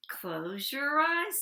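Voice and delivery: slow, whispered and soothing. Acted. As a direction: hush them gently, slowly. Frederick soothes the mice.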